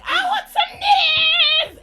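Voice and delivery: high, loud mock yell; mock childish shout. Recalling a sixth-grade wish, high hammed-up shout.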